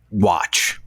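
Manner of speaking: usual speaking voice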